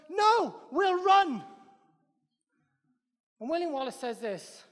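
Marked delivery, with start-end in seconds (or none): 0.1-1.4 high, loud, drawn-out shout; shouted refusal. Retold or imitated. Soldier shouts back to William Wallace's army, a slow loud yell. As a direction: bellow it back like a soldier who won't fight. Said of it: "And someone goes".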